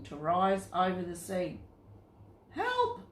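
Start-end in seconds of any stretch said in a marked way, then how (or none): 2.5-3.0 a high, thin cry for help; frightened scream. A narrator's reading. Sailors scream for help in the storm, high and frightened. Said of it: "screamed all the sailors".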